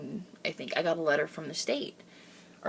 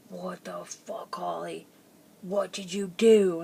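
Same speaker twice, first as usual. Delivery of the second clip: imitating stunned dad; slower than usual. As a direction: play a dad slowly taking in bad news. Dad's stunned reaction to letter.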